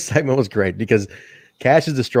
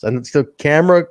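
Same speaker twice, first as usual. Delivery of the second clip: ordinary talk, nothing marked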